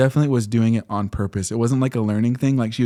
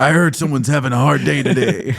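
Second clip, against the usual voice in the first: playful superhero; 3 dB louder than usual. Pretend. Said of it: "jump in the classroom".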